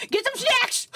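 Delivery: high-pitched, mock-insistent outburst; playful squeal. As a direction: push the treats in a sudden high yelp. Playfully urging snacks on viewers.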